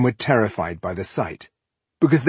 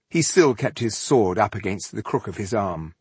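plain reading voice

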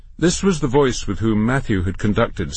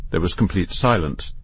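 plain reading voice

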